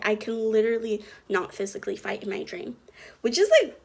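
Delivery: ordinary talk, nothing marked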